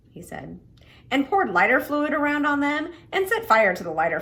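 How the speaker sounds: energetic storyteller voice; lively story reading. Acted. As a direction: read it out big for the kids. Reads novel aloud to children.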